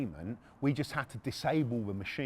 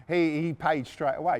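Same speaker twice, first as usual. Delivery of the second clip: ordinary delivery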